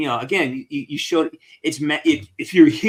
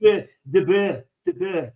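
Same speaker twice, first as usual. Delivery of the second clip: mock French accent; 2 semitones higher than usual. Host orders butter in halting mock French. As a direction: spell out each French word like a beginner. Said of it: "The butter, yeah".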